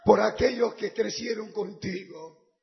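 loud, fervent sermon with shouts; fiery preaching. Acted. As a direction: preach it out to the crowd. Predicación a pleno pulmón. (predicación ferviente a gritos)

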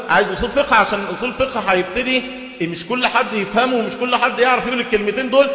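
ordinary talk, nothing marked

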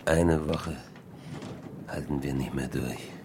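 very low, fading to hushed; exhausted castaway giving up; weak, low murmur. Acted. Starving castaway, fading strength.

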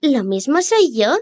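high, slow and cocky; smug. Acted. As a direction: boast with petulance. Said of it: "dijo con petulancia el pececillo".

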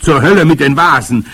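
loud, high, reckless blare; trumpeting. A narrator's reading. Grandmother scorns the boy's warning, trumpeting. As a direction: dismiss it with a booming cry. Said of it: "trompetete sie".

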